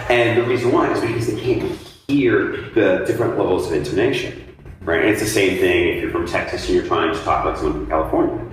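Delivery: ordinary talk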